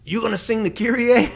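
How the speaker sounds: a playful Filipino priest impression, with a laugh; Filipino accent. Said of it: "he had a very strong accent".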